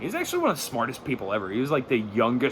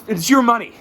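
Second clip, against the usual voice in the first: emphatic shout; 11 semitones higher, 8 dB louder than usual. Real, not acted. Stand-up rant point.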